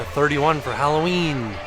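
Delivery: usual speaking voice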